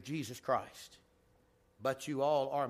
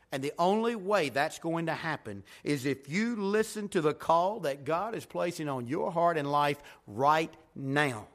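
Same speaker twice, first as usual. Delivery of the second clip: normal talking voice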